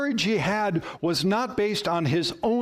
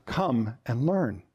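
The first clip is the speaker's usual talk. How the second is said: low, slow reply; calm reassurance; 5 semitones lower, slower than usual. Acted. Jesus answering one who doesn't know enough, calm and slow. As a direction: answer the excuse slowly and steadily.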